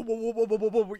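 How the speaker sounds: a mock panicked stop, whoa whoa whoa; startled whoa. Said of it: "but Saru's like".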